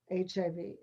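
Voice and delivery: usual speaking voice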